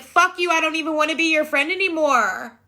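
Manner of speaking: high, childish mock tantrum; whiny little baby. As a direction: sulk like a baby disowning a friend. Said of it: "I was a little whole baby".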